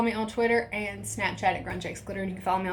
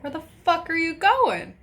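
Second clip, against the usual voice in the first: retold angry outburst, pitched up; mom rage; 7 semitones higher, 4 dB louder than usual. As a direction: act out a protective mom's outrage. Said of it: "that was my first mom rage".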